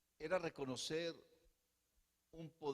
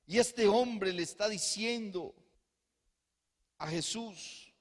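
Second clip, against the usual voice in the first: lively preaching; 6 semitones higher, 6 dB louder than usual. Acted. Preacher building intensity.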